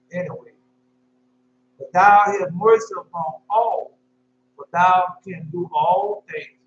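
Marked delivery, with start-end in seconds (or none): 1.8-6.6 slow, loud, solemn scripture recital; slow scripture reading. Shown as a demonstration. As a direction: lay each word down slowly, like reading from the pulpit.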